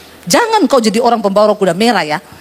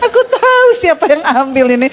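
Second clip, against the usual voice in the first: higher, laughing through the complaint to wife; laughing husband; 7 semitones higher than usual. Retold or imitated. Husband laughing as he tells his wife the money vanished, higher. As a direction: giggle while telling her the money is gone.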